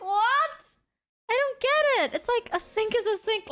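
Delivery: high, bewildered disbelief; a sink is a sink, high and baffled; baffled squeak. Real, not acted. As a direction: lift way up, truly confused. Baffled reaction to Prof's anger.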